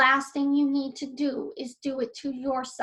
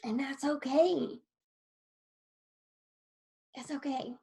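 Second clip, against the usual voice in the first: ordinary talk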